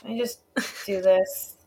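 quiet resigned mutter; muttered aside. Retold or imitated. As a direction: mutter it under your breath. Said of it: "she's like".

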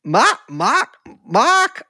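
trying out a squawky Carolina "Mark"; bird-like Carolina accent. Shown as a demonstration. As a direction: Carolina "Mark" with the r dropped, rising like a bird call. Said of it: "the mock is too bird-like".

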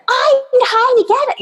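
high-pitched imitation of a screaming driver; mock yelling. Woman in her car yelling at another driver, mock yelling. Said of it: "starts yelling at me".

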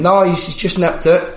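high-pitched helpful phone voice; imitated woman. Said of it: "And she went".

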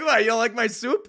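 high, loud laughing "what, you don't like my soup"; giggling fake outrage; mock indignant. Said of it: "You're like".

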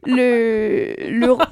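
a touch of mock English accent on Le, le ro-; playful English-accented French. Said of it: "Je vais faire l'accent anglais".

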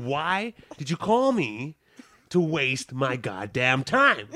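slow furious demand to the clerk; slow angry demand. Retold or imitated. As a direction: spell it out to the clerk. Angry customer demanding answer.

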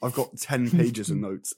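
normal talking voice

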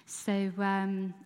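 ordinary talk, nothing marked

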